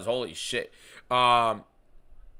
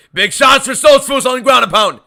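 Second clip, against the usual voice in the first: much higher and louder fight call; yelling play-by-play; 11 semitones higher, 13 dB louder than usual. Real, not acted. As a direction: scream the ground and pound.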